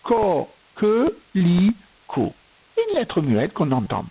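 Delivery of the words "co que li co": "Co, que, li, co" in four separated syllables. Shown as a demonstration. Teacher breaking down the word for a pupil, syllable by syllable. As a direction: dictate "Co, que, li, co" with gaps between syllables. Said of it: "la même syllabe qu'au début".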